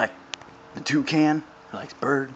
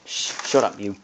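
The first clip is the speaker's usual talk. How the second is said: ordinary talk, nothing marked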